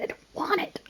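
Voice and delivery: whispered dread. Real, not acted. Dreads talking game text.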